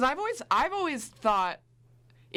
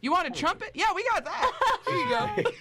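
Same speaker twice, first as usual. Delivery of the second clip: mock barista; 11 semitones higher than usual. Imagined Starbucks staff mishearing order.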